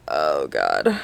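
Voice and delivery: low, soft sigh of dismay; 低落的叹息. Retold or imitated. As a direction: drop very low into a creaky, defeated groan. Dejected reaction to bad news.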